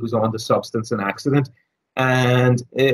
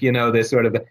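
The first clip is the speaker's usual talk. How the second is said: ordinary delivery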